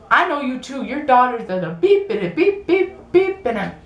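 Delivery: hammed-up censored rant; mock angry man. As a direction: sing the self-censored beeps up high. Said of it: "And he goes".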